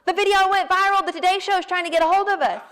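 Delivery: high, fast excited shout; excited yelling. Retold or imitated. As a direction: yell the news across the barn. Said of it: "I'm yelling to Sloan".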